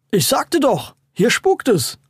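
put-on high-pitched indignant child's complaint; insist, as an aggrieved kid; complaining. A narrator's reading. Boy complains that nobody believed him, indignant complaining. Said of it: "beschwerte sich Schrankwand zwei".

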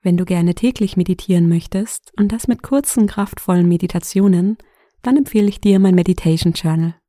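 loud, brisk promo read. Acted. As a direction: sell it like a podcast ad. Werbung am Anfang. (lauter Werbesprecher-Ton)

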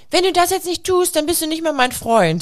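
put-on high childish pouting threat; child's threat. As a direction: play the bossy little kid. Kindergarten kids' threat quoted.

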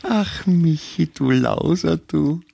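drawn-out, high teasing scold; mock scolding. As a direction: tease him slowly like a naughty boy.

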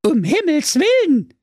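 very high, loud shocked cry; alarmed sister. Acted. Sister horrified at Brudi drinking, very high alarmed voice. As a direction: gasp it out in a little girl's panic. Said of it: "rief da dem Brudi seine Schwester".

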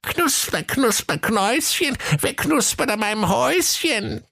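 put-on very high cackling old witch voice; shrill fairy-tale witch calling from her hut; screechy witch. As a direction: screech it like a fire alarm. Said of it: "so lieblich wie ein Feueralarm".

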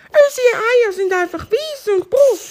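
very high clucking voice with ga ga stutters; clucking hen. Acted.

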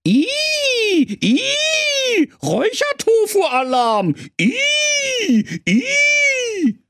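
shrill squealing alarm voice; smoke alarm voice. A narrator's reading. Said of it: "der Rauchmelder sprach".

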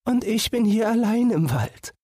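put-on understated weeping girl's voice; lightly tearful sister. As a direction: lament being left alone, mildly. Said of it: "Das Schwesterchen weinte und sprach".

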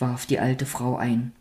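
plain reading voice

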